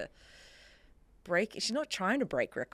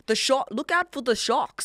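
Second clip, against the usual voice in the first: loud mock South African warning; South African accent; 5 semitones higher, 5 dB louder than usual. Guest mimicking Shark Week locals, South African accent. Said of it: "I hear the South African".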